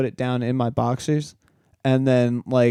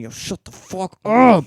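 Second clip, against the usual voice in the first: higher, louder, drawn-out exasperated yell; mock screaming; 7 semitones higher, 5 dB louder, slower than usual. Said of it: "in my mind, I'm screaming".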